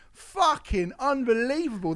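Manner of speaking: ordinary talk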